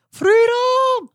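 high, loud battle cry of Freedom; mock freedom cry. Cohost hams up the text's freedom line with a yell. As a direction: belt it out like a rallying charge.

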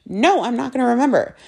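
playful refusal. Retold or imitated. Teasing boyfriend, refusing to report.

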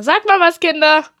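hammed-up shout for the children; mock calling out. Joking host calling to hidden children, mock shout.

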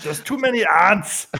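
drawn-out mock Austrian Arnold; Arnold Schwarzenegger impression. Friend joins the Arnold picnic bit in a mock Austrian accent. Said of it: "Arnold Schwarzenegger impression at a picnic".

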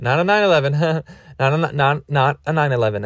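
usual speaking voice

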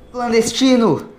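high, loud alarmed cry; female shout. A narrator's reading. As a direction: shout it out across the ship. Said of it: "soou uma voz feminina".

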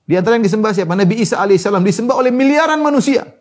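high, forceful sermon voice; impassioned preaching. Acted. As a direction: preach it out, building in pitch.

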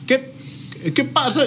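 a Colombian failing at Venezuelan; bad Venezuelan accent. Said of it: "Le sale muy mal".

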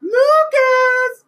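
very high piercing yell; shouting. Real, not acted. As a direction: scream his name at full power. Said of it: "Hit him with the Lucas".

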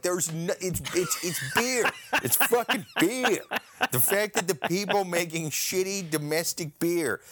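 laughing through an exasperated rant about beer. Real, not acted. Ranting to his cohost about cheap beer, laughing.

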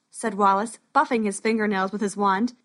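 ordinary delivery

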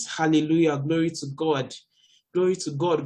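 ordinary delivery

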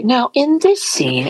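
high, slow, deliberate setup; a hint of the liaison starting her long explanation; imitating the liaison. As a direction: lightly mimic a patient director easing in. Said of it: "the Japanese liaison would say".

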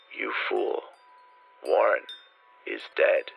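deep, hollow, slow unearthly voice. A narrator's reading. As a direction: unearthly voice from the grave. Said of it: "the voice was deep, hollow".

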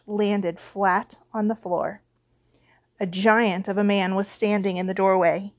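ordinary delivery

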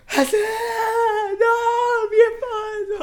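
hammed-up wailing widow, very high and loud; mock tearful shriek for a dead husband; imitated heartbroken screaming. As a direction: mimic a wife screaming in grief. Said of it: "así fue gritando".

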